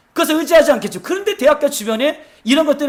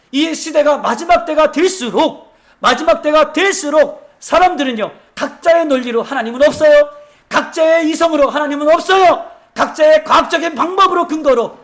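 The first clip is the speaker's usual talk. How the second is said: raised, driving sermon voice, higher and louder; fiery preaching; 5 semitones higher, 6 dB louder than usual. Acted. Pastor warning the church about the last days, fiery preaching. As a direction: preach it out to the congregation.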